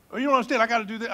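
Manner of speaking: hammed-up pleading excuse; mock protest. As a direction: act out a flustered objection. Acts out a busy churchgoer protesting.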